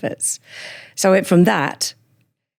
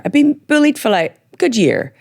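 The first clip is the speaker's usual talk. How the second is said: usual speaking voice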